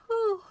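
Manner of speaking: sharp high whew. Real, not acted. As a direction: let out a big high whew. Reacting to the character's line.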